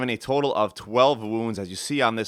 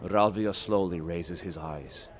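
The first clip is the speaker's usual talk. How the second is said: lower, softer storyteller voice setting a tavern scene; story narration; 6 semitones lower, 7 dB quieter, slower than usual. A narrator's reading. Narrator opening the adventure in the tavern, storytelling voice. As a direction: draw listeners into the tale, unhurried.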